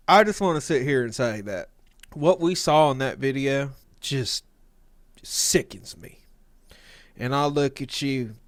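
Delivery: low, dramatic closing argument; sway the jury gravely; prosecutor voice. Acted.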